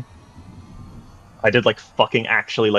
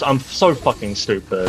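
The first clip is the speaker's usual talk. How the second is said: usual speaking voice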